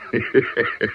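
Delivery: brief self-satisfied laugh; short gloating chuckle. Acted. Gangster chuckling after fooling the caller. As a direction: chuckle at the trap you set.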